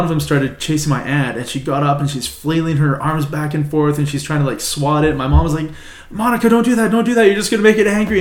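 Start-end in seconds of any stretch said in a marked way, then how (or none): 6.1-8.2 high urgent mom warning; pitched-up pleading warning; imitating mom. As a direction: mimic a worried mother scolding her sister. Mom warning aunt in retold story.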